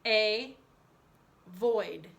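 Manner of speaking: "A void" as two separate syllables, long AY, pause, VOID; spelling pronunciation. Shown as a demonstration. Teacher leading pupils' spelling chant, "A void" split as AY, void. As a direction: break "A void" apart, giving A its letter name. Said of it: "for spelling we say".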